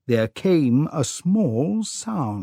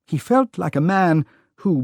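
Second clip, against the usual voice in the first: ordinary delivery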